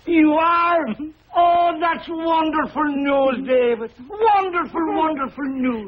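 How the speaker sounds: high, slowed, joyful exclamation; delighted. Acted. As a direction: beam with delight. Delighted reply.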